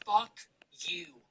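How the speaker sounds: mock cursing under the breath; imitated mouthed retort. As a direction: act out mouthing it, barely voiced. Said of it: "He goes".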